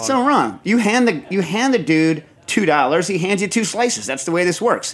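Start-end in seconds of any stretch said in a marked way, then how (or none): 0.0-0.6 pitched way up, emphatic; high emphatic exclaim. Real, not acted. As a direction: say it high with disbelief. Echoing co-host, emphatic.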